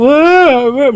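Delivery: high-pitched fake baby wails, wah wah; mock crying wah wah. Shown as a demonstration. Parent shows the child how Mami cries, mock wailing. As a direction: wail wah wah like a crying mommy.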